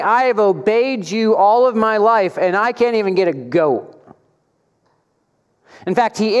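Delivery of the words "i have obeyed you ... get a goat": disrespectful son. Retold or imitated. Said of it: "this tone of disrespect".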